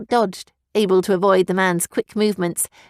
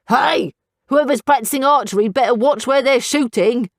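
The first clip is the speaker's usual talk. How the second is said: shout; 4 semitones higher than usual. A narrator's reading. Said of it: "Scout shouted".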